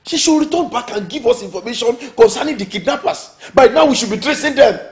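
loud, high-pitched impatient complaint; agitated demand. Acted. Anxious quarrel over missing daughter.

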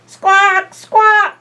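high loud parrot squawk; shrill-free loud bird call, high. Acted. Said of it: "says the bird".

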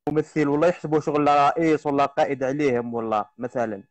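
normal talking voice

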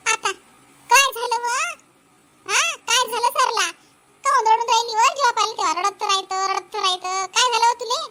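high-pitched, scolding questions; exasperated elder's nagging; impatient questioning. Acted. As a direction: demand to know why she is crying. Mother-in-law demands why she cries.

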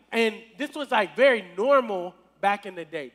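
normal talking voice

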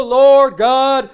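high, slow, hammed-up preacher's call; mock a faith healer crying out to heaven; mock Pentecostal preacher. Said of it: "it's like he's expecting a Pentecostal".

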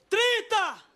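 high-pitched mock bidding call; acted auction yell; retold bid shout. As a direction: call the figure across the room. Rival shouts a higher bid.

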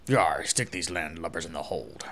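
low gruff pirate captain. Acted. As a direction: growl it like a pirate. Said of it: "I think my pirate voice is getting better".

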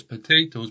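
"potatoes" as puh-TAY-toes; American pronunciation. Shown as a demonstration. Teacher quoting the song, American "potatoes" with TAY. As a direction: say "potatoes" with AY in the middle. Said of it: "They say potatoes".

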